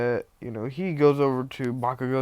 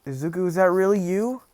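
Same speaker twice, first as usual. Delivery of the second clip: high, rising, tearful question; mock tearing up; 6 semitones higher than usual. Bakugo seeing his lost friend, mock tearful and high. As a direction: play lightly choked-up disbelief. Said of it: "Bakugo starts tearing up".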